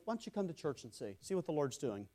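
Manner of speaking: telling a confused friend to come to church, softly; soft invitation. Acted. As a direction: drop the voice and invite them in.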